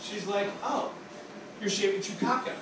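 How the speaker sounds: ordinary talk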